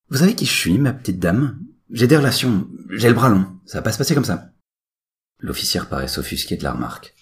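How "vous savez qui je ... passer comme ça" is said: loud, high indignant threats; angry outburst. Acted. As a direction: puff up and threaten her. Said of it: "le changement de ton est perceptible".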